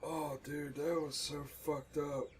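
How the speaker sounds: usual speaking voice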